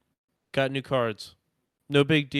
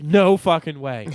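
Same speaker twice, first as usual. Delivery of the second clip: louder burst of disbelief; disbelieving outburst; 3 semitones higher, 9 dB louder than usual. Retold or imitated. Man on the toilet hearing the knock, loud disbelieving outburst. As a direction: blurt it out, can't believe the timing.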